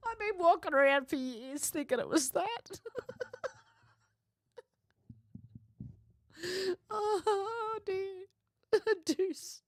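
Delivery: high, laughing through the words; can't stop giggling at yourself; real laughing fit over a misheard lyric; laughing helplessly. Real, not acted. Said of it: "I'm amusing myself here".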